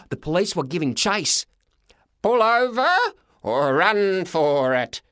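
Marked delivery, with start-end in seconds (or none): 2.2-5.0 Toothless yelling. A narrator's reading. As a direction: shout the choice at the driver. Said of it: "yelled Toothless".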